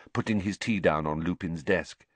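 usual speaking voice

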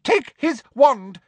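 very high, loud, slow bark; barked order. Acted. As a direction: snap an order at a minion. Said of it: "she barked".

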